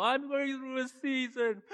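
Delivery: parodying a churchgoer with fake crying; mock crying. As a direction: ham up a crybaby lament. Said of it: "people go".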